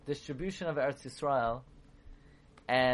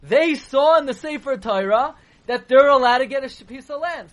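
much louder and higher, objecting hard; loud protest; 8 semitones higher, 11 dB louder than usual. Real, not acted. Rabbi raising his voice against a simple reading, loud protest. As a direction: cry out that it isn't so.

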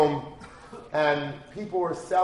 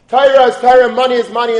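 louder and higher on both halves; emphatic contrast; 8 semitones higher, 10 dB louder than usual. Retold or imitated. A father hammering home the lesson, stressed. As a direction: hammer each Torah and money.

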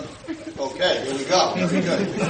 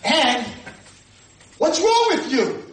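high, loud, drawn-out challenge; confrontational demand; 12 semitones higher, 8 dB louder, slower than usual. Retold or imitated. Former student confronts her rabbi, loudly scolding. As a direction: imitate someone scolding at the top of the voice.

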